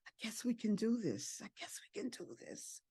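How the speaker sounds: very soft, fading to a whisper; hushed surrender. Retold or imitated. Woman quietly giving in to God, hushed. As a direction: say it under the breath, fading away.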